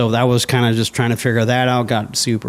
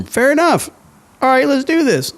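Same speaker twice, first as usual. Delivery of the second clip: much higher, sudden cheerful squeak; mock eager self; 14 semitones higher than usual. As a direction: play his younger self, caught off guard. Reacting to his own prayer prompt.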